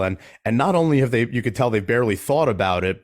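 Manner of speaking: usual speaking voice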